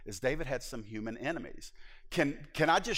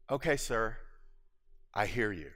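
calm reply; 4 semitones lower than usual. Retold or imitated. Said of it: "I said".

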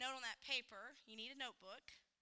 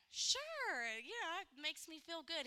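bright high eager yes, much higher; happy agreement; 6 semitones higher, slower than usual. Retold or imitated. Wife happily agreeing to cook his dish, high and eager. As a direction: chirp it happily like a pleased wife. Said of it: "Then I'm gonna be like".

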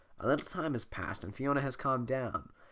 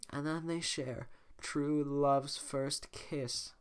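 high, slow dreamy princess; 5 semitones higher, slower than usual. Acted. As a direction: recite it like a fairy tale princess. Voicing Fiona.

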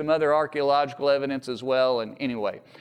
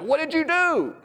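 alarmed sailors; 12 semitones higher than usual. Retold or imitated. Sailors confront Jonah after lots.

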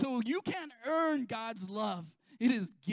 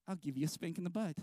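joking inner thought; 6 semitones lower, 4 dB quieter, faster than usual. Retold or imitated. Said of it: "I think in my head".

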